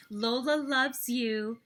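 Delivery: ordinary delivery, nothing marked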